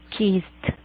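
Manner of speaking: "Kissed" as one syllable, kist; "Kissed" with a silent e. Shown as a demonstration. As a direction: end "Kissed" with a released t. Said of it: "suena como una T".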